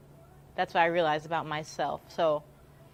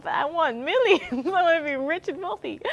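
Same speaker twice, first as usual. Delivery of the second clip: mock whine; 10 semitones higher, 3 dB louder than usual. The vlogger complains to the higher power in a mock whine. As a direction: pout like a kid denied a toy. Whining about wanting millions.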